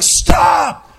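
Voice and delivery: high, sudden acted cry to stop; urgent warning shout. Pastor stopping someone heading for danger, urgent high shout. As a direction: escalate into an alarmed call.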